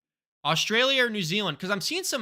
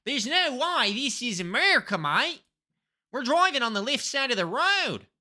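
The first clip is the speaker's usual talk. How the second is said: hammed-up Aussie mate, lively and higher; playful, swinging Australian accent; 5 semitones higher, 3 dB louder than usual. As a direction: put on a broad Australian accent. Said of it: "I do an accent of where I think I am".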